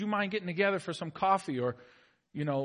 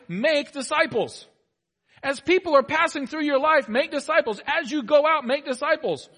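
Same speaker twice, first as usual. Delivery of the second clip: preaching at full force; 9 semitones higher, 9 dB louder than usual. Real, not acted. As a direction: preach it out, every line a proclamation.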